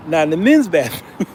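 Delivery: ordinary talk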